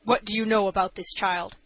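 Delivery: mildly stern judge; understated, authoritative questioning. A narrator's reading. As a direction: question with a touch of severity. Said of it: "asked the judge sternly".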